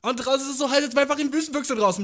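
ordinary talk

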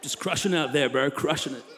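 soft, low lazy bro voice; laid-back surfer bro. Acted. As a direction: toss it off like a chill surfer.

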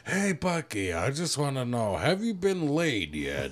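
hushed dad impression. Friend imagining the dad's call, soft and low. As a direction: murmur it like a creepy old dad. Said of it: "I thought you'd be like".

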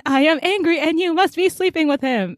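hammed-up wounded boyfriend; mock jealous boyfriend. Mocking Drew's jealous accusation.

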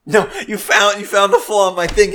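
loud, high mock dismay; mock outrage. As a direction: overplay the outrage. Mock despair at being foiled.

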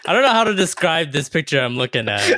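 high-pitched, laughing as he talks; struggling through laughter. Real, not acted. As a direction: try to describe it while cracking up. Host cracking up at the picture.

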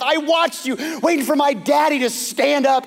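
high, loud pleading outburst; impassioned plea. Retold or imitated. Michal confronting her father Saul for David, impassioned and loud. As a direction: stand up to him and let it pour out.

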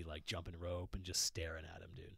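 hushed menacing joke; whispered joke. Real, not acted. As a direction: whisper it like a threat. Joking about intimidating the technician.

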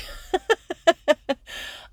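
light high-pitched giggle. Real, not acted. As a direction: giggle at yourself. Laughs about fearing bees.

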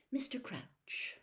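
usual speaking voice

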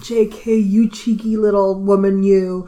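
loud, mock-outraged admiration; playful scolding. Reader reacting to the author's cheeky trick, playful scolding.

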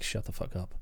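very soft, low, almost whispered; exasperated mutter. Retold or imitated. Frederick next to her in the pool, exasperated mutter. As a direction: mutter it under the breath.

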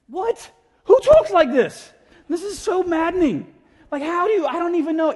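very high, loud bafflement; mock exasperation. Woman baffled by Jesus' odd answer, mock exasperation. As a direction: blurt it out, hammed-up and maddened.

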